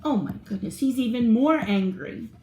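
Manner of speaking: plain reading voice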